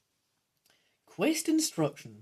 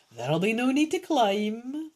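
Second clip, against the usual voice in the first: lightly voiced old man, higher pitch; a touch of old-man character, raised in pitch; old geezer; 5 semitones higher, 4 dB louder than usual. Old man advises the hero, lightly voiced old geezer. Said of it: "the old man informs you".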